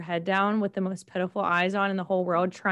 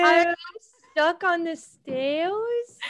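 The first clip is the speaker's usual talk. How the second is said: higher, slow whiny dog voice; dog voice, pitched up and slow; dog's voice; 7 semitones higher, much slower than usual. Pretend. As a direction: speak for the stuck dog, slowly and plaintively. Voicing the dog's thoughts.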